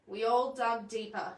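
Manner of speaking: ordinary delivery, nothing marked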